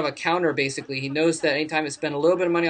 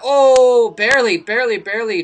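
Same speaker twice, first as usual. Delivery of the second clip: excited; 7 semitones higher, 11 dB louder than usual. Real, not acted. Caster reacts to near-miss.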